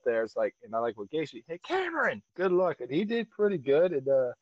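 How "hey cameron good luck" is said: high-pitched mock well-wishing; imitated call-out. Co-host imitating a wrestler calling Cameron, high. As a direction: call the name high, then wish luck.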